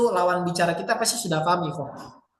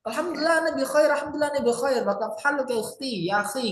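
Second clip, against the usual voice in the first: high, rapid cheerful greeting; reply happily at speed, pitched well up; jawaban bahagia; 5 semitones higher, much faster than usual. Shown as a demonstration. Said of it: "kita jawabnya bahagia". (jawaban bahagia, cepat dan bernada tinggi)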